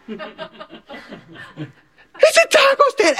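put-on high, wailing kid sob; mock sobbing. Brother finding only a taco stand, mock sobbing wail. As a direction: whimper it like a heartbroken nine-year-old. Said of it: "he just starts sobbing".

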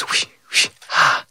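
soft breathy whooshing of a fan. Retold or imitated. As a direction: imitate a little hand fan, then a sigh. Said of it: "She goes".

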